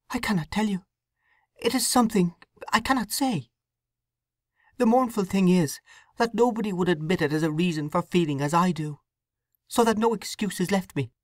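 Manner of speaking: put-on a troubled young wife confessing; young woman Sue. Sue refuses to explain.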